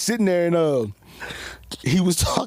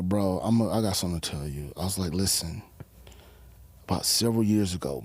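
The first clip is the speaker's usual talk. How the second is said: much lower, softer retold voice; low confiding; 10 semitones lower, 6 dB quieter than usual. Cutman about to tell a fighter something, low and confiding. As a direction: drop right down as if breaking it gently.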